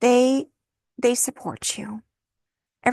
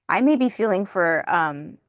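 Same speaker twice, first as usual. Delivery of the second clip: ordinary talk, nothing marked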